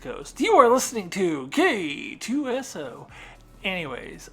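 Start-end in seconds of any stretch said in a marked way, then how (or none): 0.4-3.1 higher, louder radio announcer. Pretend. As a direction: introduce the station like a DJ. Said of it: "sound like a radio station".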